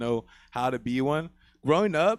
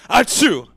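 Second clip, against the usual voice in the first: loud fake sneeze; 5 semitones higher, 10 dB louder than usual. Imitated sneeze in story.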